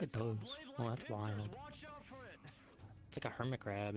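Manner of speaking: ordinary talk, nothing marked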